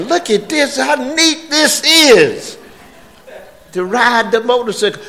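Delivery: high, slow dog voice; cartoon dog. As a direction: voice the dog's thoughts high. Imagined dog thinking about chihuahua.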